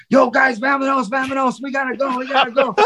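rushing the band out in feigned alarm; mock panicked promoter. Promoter bursting into the dressing room, mock panic. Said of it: "he comes storming in the dressing room".

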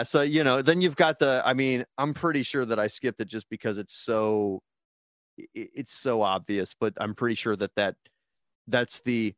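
normal talking voice

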